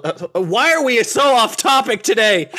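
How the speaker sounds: ordinary talk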